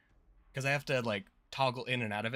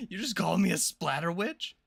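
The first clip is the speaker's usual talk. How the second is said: indignant, louder, higher; playfully offended shout; mock outrage; 5 semitones higher, 6 dB louder than usual. Real, not acted. Mock outrage at being insulted.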